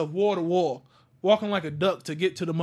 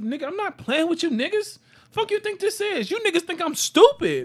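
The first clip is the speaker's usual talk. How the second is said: angry outburst; 9 semitones higher than usual. Retold or imitated. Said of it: "I was like".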